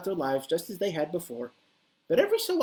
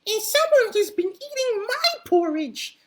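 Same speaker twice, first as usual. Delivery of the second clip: very high, slow, louder tiny squeak; squeaky Baby Bear; 6 dB louder than usual. Acted. Baby Bear sees his empty bowl, very high squeak. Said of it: "squeaked Baby Bear in his teeny tiny baby voice".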